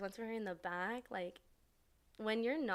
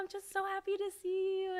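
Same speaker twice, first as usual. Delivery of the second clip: high-pitched, gushing put-on voice; imitated gushing; 11 semitones higher than usual. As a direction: mimic an overjoyed cousin, voice way up high. Cousin gushing on reunion, retold.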